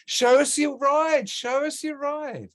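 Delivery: drawn-out, high-pitched playful chant; high playful call. Pretend. Host asking guest to show her board, high playful call. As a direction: call it out high on one held note.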